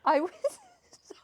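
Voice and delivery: laughing high mid-word; words breaking into laughter. Real, not acted. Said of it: "laugh in the words".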